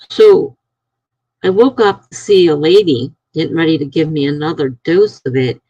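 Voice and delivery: ordinary talk, nothing marked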